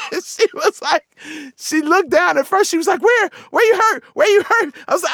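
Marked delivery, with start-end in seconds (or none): 3.0-4.7 high, urgent acted cries; mom's frantic questioning. Mom looking down at her kid for injuries, frantic high questioning. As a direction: fire off alarmed questions like a panicked mother.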